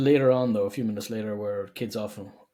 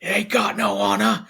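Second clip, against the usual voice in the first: high shouted cockney jab; cockney accent; 9 semitones higher, 5 dB louder than usual. Retold or imitated. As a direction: sneer it in cockney. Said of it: "He gets all cockney".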